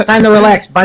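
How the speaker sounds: put-on Chicago accent. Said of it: "do your Chicago accents".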